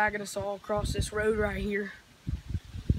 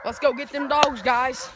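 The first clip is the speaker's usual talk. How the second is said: excited; 4 semitones higher, 6 dB louder, slower than usual. Real, not acted. Urging everyone on.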